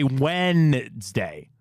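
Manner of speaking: "Wednesday" drawn out as "Wennnds-day", N before D; exaggerated Wednesday. Host imitating wife's complaint, "Wednesday" stretched as "Wennnds-day". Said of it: "I flip the N and the D".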